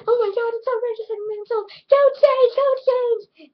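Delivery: shrieking panic, high and loud; high, loud, frantic mock alarm. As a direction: squeal it in a frantic rush. Panicked girl quote, high and loud.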